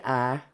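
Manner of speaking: I said as a flattened "ah"; regional I. Shown as a demonstration. Admitting a regional habit, I said as "ah". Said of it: "I still say".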